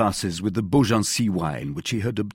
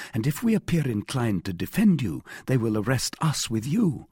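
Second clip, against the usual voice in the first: plain reading voice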